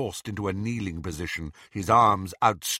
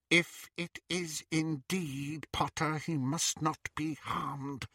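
softer, slow high muttering; muttered; 6 semitones higher, 7 dB quieter, slower than usual. Acted. Bellatrix thinking aloud, soft slow muttering. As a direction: murmur it to herself. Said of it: "she muttered".

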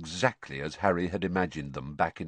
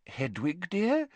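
distracted; 11 semitones higher, slower than usual. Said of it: "said Missus Weasley distractedly".

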